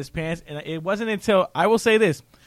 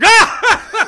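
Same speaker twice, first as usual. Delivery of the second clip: big booming belly laugh; loud guffaw; 12 dB louder than usual. Retold or imitated. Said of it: "Dimitri was like".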